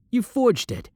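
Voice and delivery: high-pitched, indignant outburst; indignant accusation. Acted. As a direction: pitch jumps up as you accuse a rival. Accuses rival of forgery.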